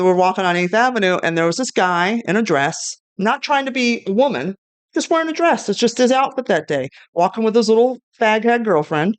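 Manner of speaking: ordinary talk, nothing marked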